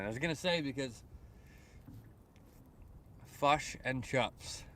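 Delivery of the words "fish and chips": put-on slow, deliberate New Zealand accent on "fush and chups"; Kiwi accent. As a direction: say "fush and chups" with Kiwi vowels, short i turned to u. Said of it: "I see it's in your accent".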